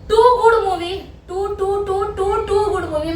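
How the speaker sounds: high-pitched, emphatic raving; gushing praise. Real, not acted. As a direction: rave about it, hammering each "too". Raving about a comedy film. (जोश में, ऊँची आवाज़ में तारीफ़)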